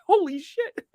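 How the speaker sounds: high, startled outburst; surprised. Real, not acted. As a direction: caught off guard by the magic. The guest banner appears suddenly.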